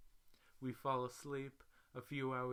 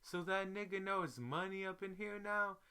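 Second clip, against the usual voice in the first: mock screaming pimp; 8 semitones higher, 3 dB louder than usual. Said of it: "Now the pimp's screaming".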